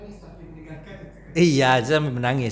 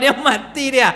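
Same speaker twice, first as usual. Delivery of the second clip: mock laughing widower; 10 semitones higher, 5 dB louder than usual. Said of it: "Beda kalau seandainya ... Bapak ketawa-ketawa".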